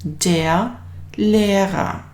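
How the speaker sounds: slow and clear, teacher modelling the word; slow model pronunciation. Shown as a demonstration. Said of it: "we are going to use the word".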